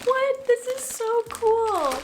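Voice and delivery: high, slow, gushing squeal; thrilled shriek. Real, not acted. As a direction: shriek with delight at the baggies. Seeing separate makeup baggies.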